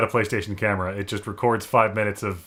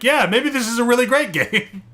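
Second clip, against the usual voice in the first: excited reaction; 12 semitones higher than usual. Retold or imitated. Enthusiastic quoted first reaction.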